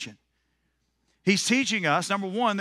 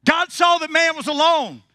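louder, higher, pushing hard on saw; bigger retake; 10 semitones higher, 6 dB louder than usual. Shown as a demonstration. Preacher retakes it to draw a stronger amen, louder. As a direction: give it more fire this time. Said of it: "I'm gonna give you another chance".

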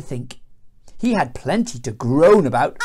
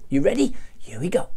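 ordinary delivery

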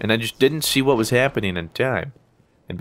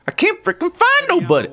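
frustrated outburst. Real, not acted. Frustrated, no enemies found.

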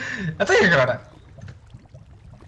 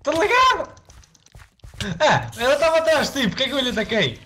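excited; 11 semitones higher than usual. Real, not acted. Still high after the scare.

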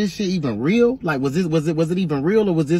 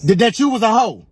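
angry insult; 5 semitones higher, 6 dB louder than usual. Acted.

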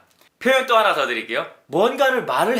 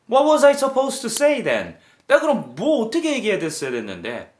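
defensive retort; 6 semitones higher than usual. Acted. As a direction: push back, offended. Defensive reply to a friend.

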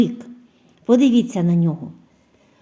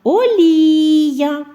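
олія said high and loud for analysis; word presented loudly; 7 semitones higher, 6 dB louder than usual. Shown as a demonstration. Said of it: "Де знаходиться звук І?". (голосно олія)

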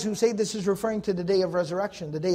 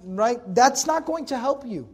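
normal talking voice

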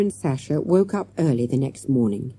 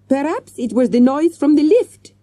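higher, louder French manager; French accent; 11 semitones higher, 7 dB louder than usual. Acted. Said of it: "he suggested".

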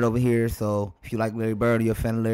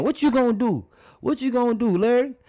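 high, challenging taunt, pitched well up; mock taunting Kareem; 11 semitones higher than usual. Kareem daring Larry on court, high mock taunt. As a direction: dare him, high and cocky. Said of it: "he started probably saying".

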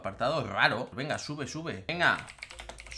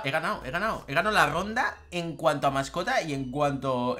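ordinary talk, nothing marked

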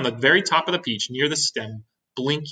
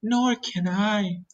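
slow, high spider voice, less piercing than a wail; Miss Spider speaking; 9 semitones higher, much slower than usual. A narrator's reading. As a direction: draw out "Nor can I" as a spider. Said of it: "said Miss Spider".

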